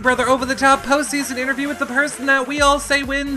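upbeat show host intro. Acted. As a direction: hype up the guest like a host.

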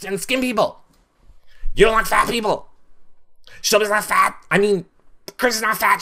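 mock Jason's overdramatic whining; high sassy impression of an upset houseguest; imitating Jason. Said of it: "Jason was like".

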